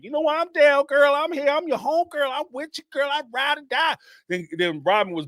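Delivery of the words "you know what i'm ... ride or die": high eager sidekick voice; eager Mary. Retold or imitated. Mary eagerly pledging loyalty to Robin, high and gushing. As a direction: gush agreement like a loyal fan. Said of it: "Mary, of course, is like".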